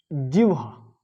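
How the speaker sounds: जिह्वा with h moved after v: "jivha"; जिह्वा with swapped h and v; common mispronunciation. Shown as a demonstration. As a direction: say जिह्वा as "jiv-ha". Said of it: "ये बोलते हैं".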